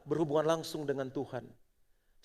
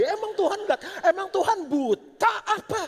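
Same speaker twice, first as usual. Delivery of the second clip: much higher, loud cry with a laugh; preaching shout; 9 dB louder than usual. Real, not acted. Preacher challenges the congregation, much higher, loud shout. Preacher's climax rhetorical question.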